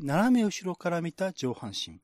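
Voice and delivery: ordinary talk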